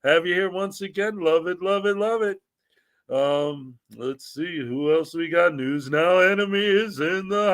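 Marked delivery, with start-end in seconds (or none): none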